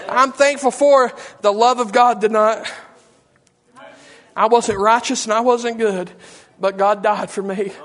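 ordinary talk, nothing marked

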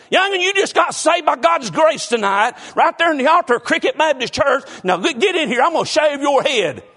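hint of a bossy mama ordering a boy to the barber; scolding mama. Preacher imagining Mama scolding the new convert, mock stern. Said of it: "did you tell that youngin'".

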